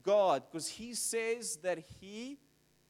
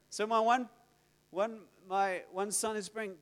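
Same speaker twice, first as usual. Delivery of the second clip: ordinary delivery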